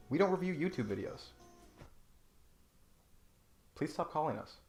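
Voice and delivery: ordinary talk, nothing marked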